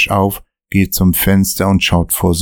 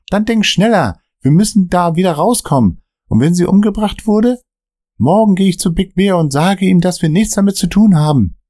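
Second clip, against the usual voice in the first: much higher, panicked cursing; he thinks they're done for; panicky Dan; 12 semitones higher than usual. Acted. Dan cursing at Michael during the car chase, panicked.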